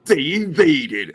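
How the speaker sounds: slow, dramatic trailer narrator; movie trailer voice. Retold or imitated.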